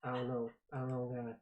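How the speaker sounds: put-on mumbling confused old man. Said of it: "a man shuffle on stage and be like".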